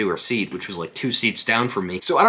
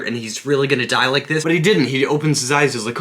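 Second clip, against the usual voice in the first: ordinary talk, nothing marked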